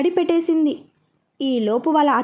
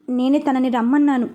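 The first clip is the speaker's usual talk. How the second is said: plain reading voice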